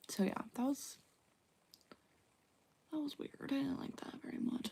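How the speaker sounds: hushed breathy whisper, almost no voice; whispered aside. Real, not acted. Whispering an aside to her viewers about birth control. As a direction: drop to a secret whisper as if confiding.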